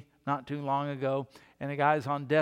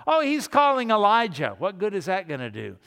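loud, higher mocking taunt; jeering crowd; 4 semitones higher, 5 dB louder than usual. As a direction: jeer at him from the crowd. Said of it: "what do they say to him".

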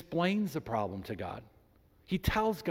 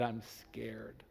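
heartfelt confession; 7 semitones lower, 13 dB quieter than usual. Retold or imitated. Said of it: "poured out his heart and said".

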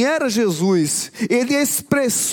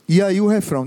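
ordinary talk

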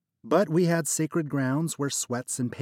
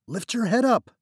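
higher, louder urgent command; urgent order; 12 semitones higher, 7 dB louder than usual. Acted. Narrator urging trapped friend to lift his head.

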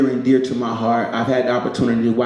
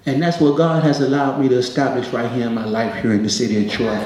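ordinary delivery

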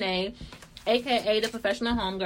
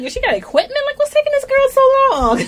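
impatient shout; 12 dB louder than usual. Retold or imitated. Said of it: "I'm like".

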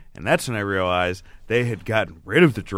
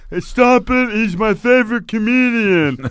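high, loud, strained mimicked voice; slow, high imitated protest; 10 semitones higher, 5 dB louder than usual. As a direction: impersonate him pleading, pitched way up. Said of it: "this is how he talked".

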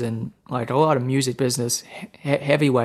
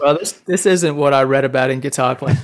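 laughing. Retold or imitated. Retelling a shocking first visit.

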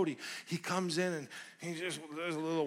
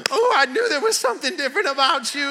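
much higher, louder lady voice; greeter's delight; 11 semitones higher, 13 dB louder than usual. Retold or imitated. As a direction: play the delighted older lady. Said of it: "she says".

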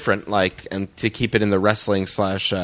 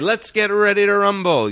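higher-pitched, lightly voiced ring announcer; ring announcer catchphrase; 9 semitones higher than usual. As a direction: quote it with a hint of a fight announcer's call. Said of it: "the guy who said".

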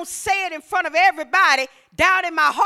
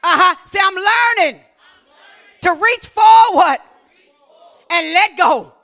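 rousing call-and-response preaching; full-force preaching; 4 semitones higher, 10 dB louder than usual. Acted. Preacher stirring the congregation, rousing and loud. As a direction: lift the crowd with every kingdom word.

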